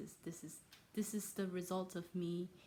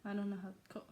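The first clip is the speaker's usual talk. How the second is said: usual speaking voice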